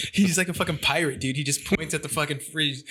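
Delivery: usual speaking voice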